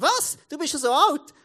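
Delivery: high, loud incredulous outburst; shocked disbelief. Retold or imitated. Friend hearing he is nearly forty, loud mock astonishment. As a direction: imitate a friend shocked, pitch leaping up. Friend's reaction to his age retold.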